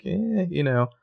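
small, high pleading noise. Retold or imitated. Imitating Allie's look to Hannah, lightly pleading. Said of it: "almost kind of pleading".

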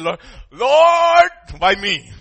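high, very loud, drawn-out "Lord"; anguished cry. Acted. Sufferer complains to the Lord, loud acted wail. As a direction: wail it up to heaven in mock despair.

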